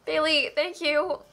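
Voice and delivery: slow, teary thanks; holding back real tears while thanking; choked up. Real, not acted. Streamer thanking donor Bailey, choked up and tearful. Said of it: "Who's cutting onions?".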